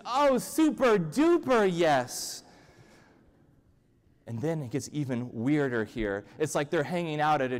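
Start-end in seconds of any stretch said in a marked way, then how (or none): 0.0-2.4 high, loud, drawn-out enthusiasm; hammed-up chorus of eager agreement; gushing yes-man reply. Prophets reply in unison to the king, gushing. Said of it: "the prophets all reply in unison".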